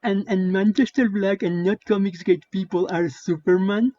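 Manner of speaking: ape him as a dimwit all the way through; mocking dim voice. Quoting a Twitter replier on the comic panels, mocking dim-witted voice.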